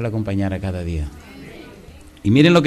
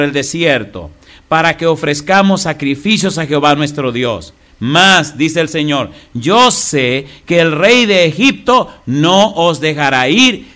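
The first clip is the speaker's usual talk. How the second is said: raised, slow preaching read; scripture reading; 3 semitones higher, 5 dB louder, slower than usual. Acted. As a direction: proclaim the verse to the congregation.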